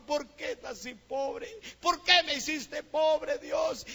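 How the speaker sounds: high, drawn-out mock wail; retold tearful cry. Boy crying out to God over being poor, retold wailing. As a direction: imitate a child crying out in protest. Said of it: "me fui chillando a mi casa ... y gritaba".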